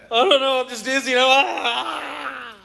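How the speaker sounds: high, loud hammed-up wail; mock dizzy freak-out. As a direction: cry out as if spinning, ending in a scream. Acting out the dizzy ride.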